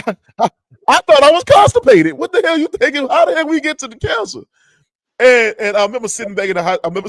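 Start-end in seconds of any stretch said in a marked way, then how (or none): 0.1-4.4 high, loud, stammering protest; hammed-up high-pitched incredulity; retold panicked disbelief. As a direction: act out indignant shock, pitched way up. Reenacts shock at cancer diagnosis.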